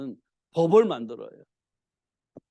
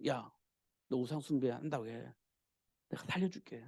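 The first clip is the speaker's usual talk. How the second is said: very soft, confidential urging; hushed coaxing; 6 semitones lower, 11 dB quieter than usual. Acted. Preacher plays the king privately offering Daniel rescue, hushed. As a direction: lower your voice as if offering a secret deal.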